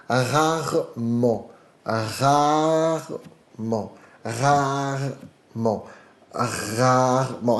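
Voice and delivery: rarement drawn out very slowly, French r. Shown as a demonstration. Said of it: "preste atenção como eu falo".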